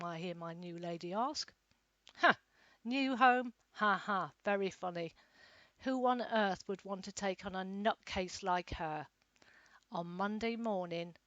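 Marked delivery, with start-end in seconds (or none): 2.2-9.1 loud, mocking scoff at the idea; mocking laugh. A narrator's reading. Carer mocks the lady's question.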